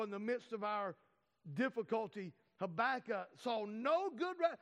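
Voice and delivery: usual speaking voice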